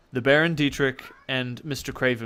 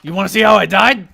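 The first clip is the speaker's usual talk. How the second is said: eager boast; 6 semitones higher, 13 dB louder, faster than usual. Acted. GM voicing excited ghost.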